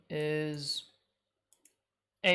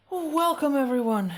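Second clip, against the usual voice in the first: big stream greeting; 9 semitones higher, 9 dB louder than usual. Shown as a demonstration. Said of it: "Let me try that again".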